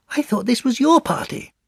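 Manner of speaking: very high, small voice; Piglet. Acted. Piglet confiding in Pooh, high-pitched.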